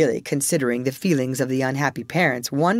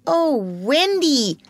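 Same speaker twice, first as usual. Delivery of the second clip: eager cry; 11 semitones higher, 4 dB louder than usual. Acted. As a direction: beg her to say yes. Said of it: "cried Toodles".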